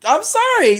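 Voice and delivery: exasperated squeak. Real, not acted. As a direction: squeal "I'm sorry." in disbelief at the plot. Exasperated outburst at stupid movie.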